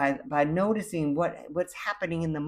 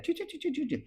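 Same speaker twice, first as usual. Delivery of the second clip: much higher, soft mocking choo choo noise; mock chatter; 13 semitones higher, 6 dB quieter, much faster than usual. Mocking the jibber jabber of the world, high noise. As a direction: mimic meaningless noisy chatter. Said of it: "jibber jabber out there".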